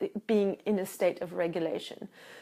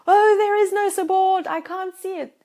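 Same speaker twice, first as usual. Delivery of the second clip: higher, louder mock whine; whiny complaint; 9 semitones higher, 8 dB louder than usual. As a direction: whine that there's no help at all. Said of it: "most of you will say".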